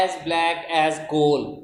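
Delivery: very slow, each word spaced; slow, spaced classroom example; slow example phrase. Shown as a demonstration. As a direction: spell the simile out slowly. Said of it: "जैसे हम कहते हैं".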